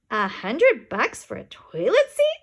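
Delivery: scoffing lady. A narrator's reading.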